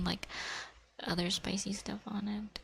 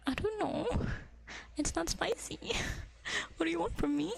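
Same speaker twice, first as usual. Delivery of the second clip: much higher, whiny mock protest; exasperated whine; 8 semitones higher than usual. Answering mom about the chili, high exasperated whine. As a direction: throw up your hands, pitched way up.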